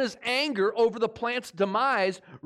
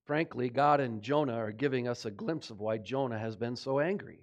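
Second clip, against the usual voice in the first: ordinary talk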